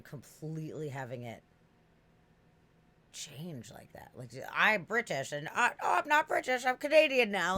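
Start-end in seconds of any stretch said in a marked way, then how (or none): 4.5-7.5 loud, high, laughing mock voice of someone declaring they're British; mock accent switcher. Mocking a person who lost their British accent, loud and laughing. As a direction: ham up a person who swaps British for Canadian.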